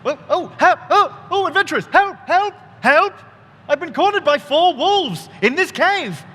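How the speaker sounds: high, desperate cries for help; panicked bird man. Acted. Aarakocra begging the adventurers to save him, panicked cries.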